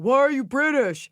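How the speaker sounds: high, loud mock heckle; retold yell. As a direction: yell the question across, imitating a stranger. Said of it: "this guy yells out".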